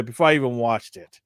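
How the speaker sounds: ordinary delivery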